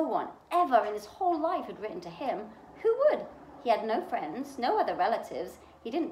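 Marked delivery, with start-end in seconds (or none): 2.8-3.2 incredulous. A narrator's reading. Narrator's rhetorical question, emphatic.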